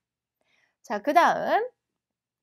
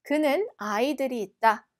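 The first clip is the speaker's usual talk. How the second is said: ordinary talk, nothing marked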